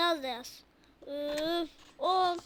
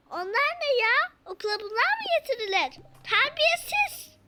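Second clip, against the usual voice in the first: high, loud scolding; furious teacher shouting terbiyesiz; angry teacher; 8 semitones higher, 5 dB louder than usual. Acted. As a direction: snap at the kids, building to a shriek.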